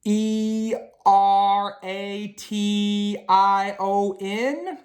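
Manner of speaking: letter by letter, very slow and careful; hesitant spelling bee letters, rising at the end. Acted. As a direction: spell it out one letter at a time, unsure.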